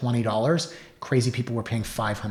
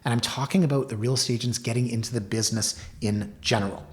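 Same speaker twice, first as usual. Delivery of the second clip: ordinary talk, nothing marked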